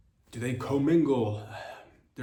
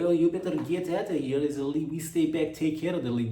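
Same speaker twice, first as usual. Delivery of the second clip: scheming Russians; 5 semitones higher, 4 dB louder than usual. Acted. Russians voiced plotting takeover.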